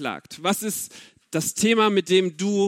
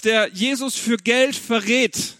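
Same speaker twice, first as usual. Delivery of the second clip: ordinary delivery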